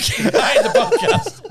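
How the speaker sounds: high, loud, helpless laughing words; through laughter. Real, not acted. Joking with co-host about the episode title, through laughter. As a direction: barely get it out for laughing.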